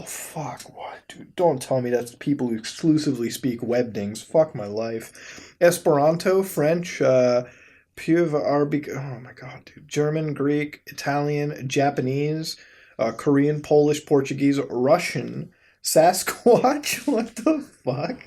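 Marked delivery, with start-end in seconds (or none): 0.0-1.3 whispered, much softer disbelief; stunned whisper. Real, not acted. Vlogger stunned by an emoji language, whispering. 17.1-18.2 slow laughing what the fuck, higher; laughing disbelief. Real, not acted. Vlogger laughing that Sasquatch is a language, amused.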